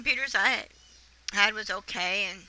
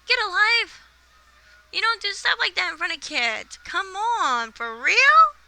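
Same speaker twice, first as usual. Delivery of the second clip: high, disgusted scolding; exasperated rant; 8 semitones higher than usual. Retold or imitated. Wife ranting about husband's webcam chat, exasperated. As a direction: throw it at him, fed up.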